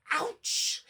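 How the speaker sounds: high, cold yelp; shivering. A narrator's reading. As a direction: jolt from icy water. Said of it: "he shivered".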